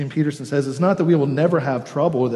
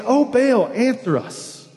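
high, louder cry to Baal; pleading prophets of Baal; 9 semitones higher, 3 dB louder, slower than usual. Retold or imitated. Preacher voicing Baal's prophets, a high pleading cry. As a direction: call out like desperate priests begging their god.

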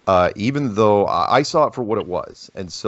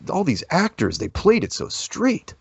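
impressed friend; 11 semitones higher than usual. Retold or imitated. Retelling friend's amazed reaction.

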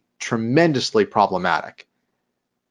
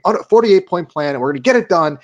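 higher and a bit louder, hammed-up cheer from the staff; mock excited cheering; 7 semitones higher, 3 dB louder than usual. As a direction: cheer along like a thrilled office crowd. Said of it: "They're screaming".

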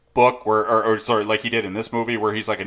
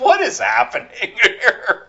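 laughing disbelief; 11 semitones higher than usual. Retold or imitated. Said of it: "it's just like".

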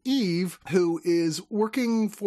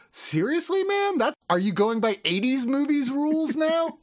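incredulous; 10 semitones higher than usual. Retold or imitated. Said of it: "wouldn't you look at it happening and go".

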